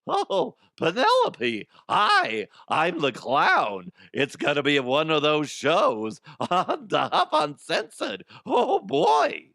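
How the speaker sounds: very high, loud, slow hammy clown. Acted. Host plays a clown greeting Penelope, high and slow. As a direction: stretch the words like a creepy party clown. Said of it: "I'm trying to find my clown voice".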